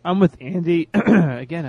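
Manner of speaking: scratchy throat, clearing it; throat problem. Real, not acted. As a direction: talk through a tickly throat. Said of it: "I have a throat problem here".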